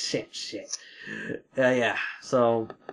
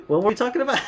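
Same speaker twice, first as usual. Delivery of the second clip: exasperated outburst; 9 semitones higher, much faster than usual. Real, not acted. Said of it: "forgetting topic, exclaimed".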